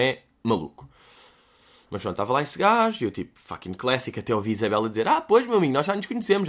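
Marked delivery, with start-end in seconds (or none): 5.1-6.5 high, sugary forced-polite voice; lightly voiced strained niceness; a hint of a celebrity greeting a fan; imitating polite celebrity. Imitating Isabel Silva greeting fan.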